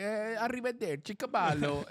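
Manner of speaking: high, hammed-up Italian voice; mock Italian accent. Italian food stand seller addressing a tourist, mock Italian accent. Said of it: "Italian, so he was like".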